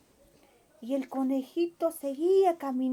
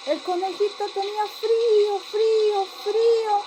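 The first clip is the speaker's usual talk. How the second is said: getting louder and higher with each frío; each frío colder and louder; cold building cry; 7 semitones higher, 7 dB louder than usual. A narrator's reading. Rabbit freezing, rising cry. (frío cada vez más fuerte y agudo)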